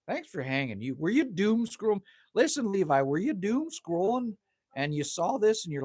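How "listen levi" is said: ordinary delivery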